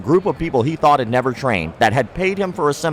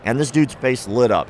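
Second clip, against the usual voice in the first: usual speaking voice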